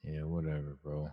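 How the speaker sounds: imitating a boy's low-energy brush-off, soft; half-hearted, dismissive mumble of a kid; standoffish son. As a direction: shrug it off like an uninterested son. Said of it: "he's kinda like".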